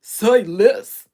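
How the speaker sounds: put-on very loud, cocky Compton accent. Streamer imitating cocky pit-bull men, loud Compton accent. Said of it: "stand real cocky just like them pit".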